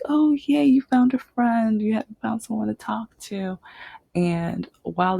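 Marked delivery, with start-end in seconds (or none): 0.0-3.6 high-pitched happy cheer, easing off; delighted parents. Retold or imitated. Parents to signer who befriended their child, delighted gushing. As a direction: gush like a thrilled parent.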